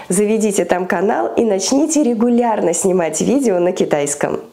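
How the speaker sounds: ordinary talk, nothing marked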